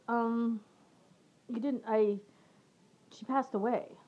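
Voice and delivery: halting, pitched a little up; hesitant. Retold or imitated. Telling an acquaintance that Cheryl died, hesitant. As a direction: break it haltingly, pausing between fragments. Breaking a death to cheerful acquaintance.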